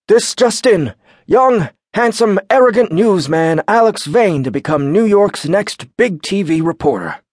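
high, loud, hammed-up anchor voice; exaggerated news anchor. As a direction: ham up a breaking news intro. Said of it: "switched to an exaggerated news anchor voice".

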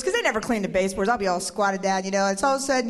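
ordinary talk

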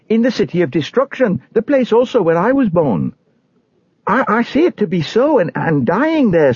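high-pitched, fervent warning; impassioned high-voiced pilgrim. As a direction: plead with your neighbours in a high, urgent voice.

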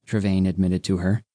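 plain reading voice

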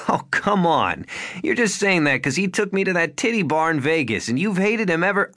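playful wife brushing off the accusation; a hint of amused protest; [name], teasing protest.

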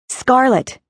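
high desperate call into the forest. Acted. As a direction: shout her name, panicked. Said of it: "scream into the forest".

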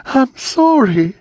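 high, loud begging scream, slowing on the name; screaming plea. A narrator's reading. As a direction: plead in a desperate scream. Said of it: "Anne screamed".

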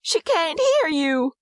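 high, drawn-out despairing cry; mother wailing it slowly, high-pitched; anguished cry. A narrator's reading. Mother crying to her husband about the baby, anguished. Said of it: "cries Kate".

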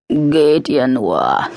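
slow low grumble; grumbling. A narrator's reading. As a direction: grumble it lazily. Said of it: "brummelte Max". (langsames, brummiges Murmeln)

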